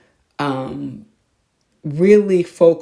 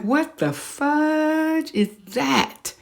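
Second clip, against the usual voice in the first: shocked; 11 semitones higher, much slower than usual. Retold or imitated. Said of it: "I'm like".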